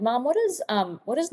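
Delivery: put-on high-pitched questioning kid voice; child asking mom. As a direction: play a puzzled kid calling up to mom. Child asking mother, retold.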